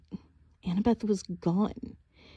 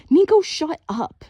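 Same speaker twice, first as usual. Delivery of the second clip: high, loud slow yell at brother; furious shout; 10 semitones higher, 9 dB louder than usual. A narrator's reading. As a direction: cut her brother off in fury. Sister yells at brother.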